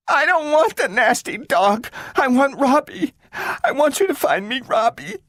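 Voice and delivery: put-on high, upset child pleading; girl demanding her robot back; tearful girl. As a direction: refuse the dog near tears. Child refuses dog, tearful.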